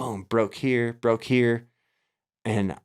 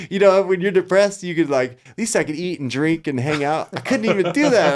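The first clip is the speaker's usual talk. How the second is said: high, loud laughing delivery; half-laughing at his own misery; laughing through it; 7 semitones higher, 5 dB louder than usual. Real, not acted. Laughing while telling the host he couldn't even eat.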